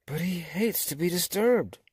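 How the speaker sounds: alarmed animals. A narrator's reading.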